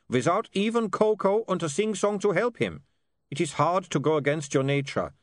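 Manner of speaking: put-on earnest, measured foreign-accented voice; Otto's foreign accent. A narrator's reading. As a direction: plead for a friend in a foreign accent.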